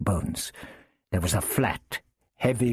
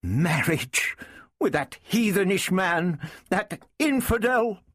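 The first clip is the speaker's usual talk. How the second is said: incredulous, rising ever higher; high, scandalised disbelief; suppressed emotion; 9 semitones higher than usual. A narrator's reading. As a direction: spit out the outrage in a high woman's voice. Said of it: "her voice crackling with suppressed emotion".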